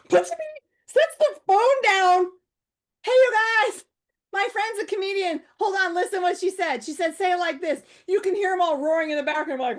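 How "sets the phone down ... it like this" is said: calling to friends. Retold or imitated. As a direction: call the whole group over. Friend shouting to group.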